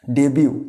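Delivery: "ডেবিউ" as de-byu, silent t; correct pronunciation. Shown as a demonstration. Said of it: "এইগুলোকে কী বলবো আমরা". ("ডেবিউ" সঠিক উচ্চারণে, ট নীরব)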